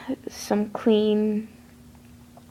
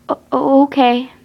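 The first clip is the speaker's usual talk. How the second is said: scared stammer; 4 semitones higher, 4 dB louder than usual. A narrator's reading.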